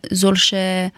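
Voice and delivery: ordinary talk, nothing marked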